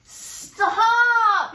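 big loud booming stop; gorilla's yell. A narrator's reading. Gorilla yelling at the fleeing mouse. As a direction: bellow it to halt her. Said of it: "yelled the gorilla".

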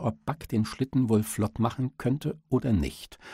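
ordinary delivery

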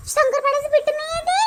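high gasp of surprise; gasp, then ask in disbelief; gasping shock. Acted.

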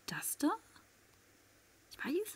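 tense hushed whisper. Real, not acted. Hiding player whispering tensely to viewers. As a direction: whisper so the enemy can't hear.

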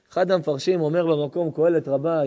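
normal talking voice

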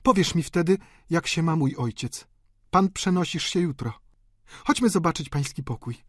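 high female character voice; brisk society lady. A narrator's reading. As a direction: chat on while taking charge. Delphine's character voice.

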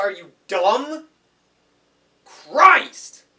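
building to a furious shout; angry outburst. Acted. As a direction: snap and explode on Christ. Losing temper at viewer.

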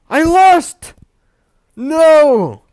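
very high, very loud despairing shout; crushed defeat scream; anguished yell. Real, not acted. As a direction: wail at the loss. Reacting to losing.

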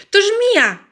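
frightened mother cat shrieking; terrified scream. Acted. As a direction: scream at the sight of a snake. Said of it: "wrzasnęła przerażona kocia mama".

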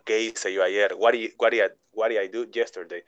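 mild mock hesitation of a puzzled student; hesitant student thinking aloud. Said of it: "ya se quedan". (estudiante dudando en voz más baja)

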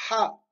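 Ha with air pushed from the throat; breathy throat h. Shown as a demonstration. Teacher models Ha, air from the throat. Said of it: "il faut que l'air sorte de la gorge".

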